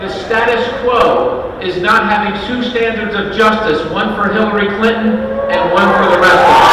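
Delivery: loud, rousing rally speech, swelling to a shout and then easing; rally oratory. Acted. A candidate firing up a rally crowd, loud and rousing. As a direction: pump up the crowd line by line.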